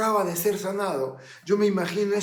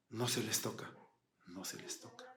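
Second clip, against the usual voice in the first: hushed emphasis; 7 semitones lower, 17 dB quieter, faster than usual. Acted. Stressing the taboo.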